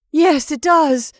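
high, loud, slow with horror; horror-stricken. Acted. Mrs Brigham agrees it looks like Edward, horror-stricken. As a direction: agree in dread. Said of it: "whose horror-stricken tone matched her sister's".

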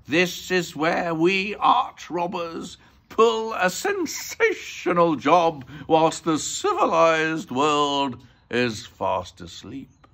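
slow, loud, drawn-out villain voice; art robber, boastful. A narrator's reading. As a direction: savour each word like a crook announcing a big job.

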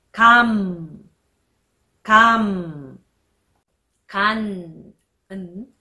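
かん closing in turn on held m, n, then ng; nasal batchim endings. Shown as a demonstration. Teacher reads batchim examples, held nasal endings. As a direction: let each かん fall into a long nasal consonant. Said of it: "パッチムを入れて読んでみましょう".

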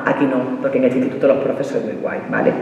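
quiet, placating side remark; confidential aside. Acted. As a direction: drop into a hushed aside. Reassuring aside to host school's teachers.

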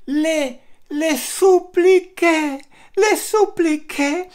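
high, slow pleading. Retold or imitated. Telling Juan how he begged the man, high slow pleading. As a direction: stretch it out like begging. Begging the rude passenger.

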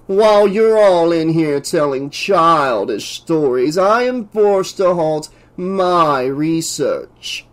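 slow, drawn-out haughty professor; pompous scientist, irritated. Acted. Professor interrupts the crew's stories, pompous and irritated. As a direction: stretch the words with self-important annoyance.

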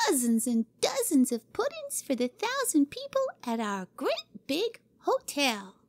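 proud of her cooking; gleeful yell. Acted. Cora announcing puddings to Jimmy: gleeful high girl voice.

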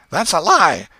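loud, high cry of "lie"; shouted accusation. Acted. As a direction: yell it at the other boy. Boy shouts accusation in quarrel.

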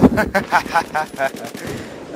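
staccato burst of laughter; laughing. Real, not acted. Gamer laughing after a startle, staccato laugh. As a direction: break into a quick run of laughs.

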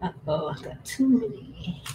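usual speaking voice

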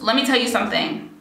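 normal talking voice

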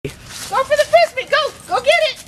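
excited dog command. Real, not acted. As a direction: send the dog off with a bright high call. Owner sending dog for Frisbee.

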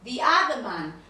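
"The" said as thee in "The other man"; "The other man" with the long thee sound; the before vowel. Shown as a demonstration. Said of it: "acá sí vamos a pronunciar the".

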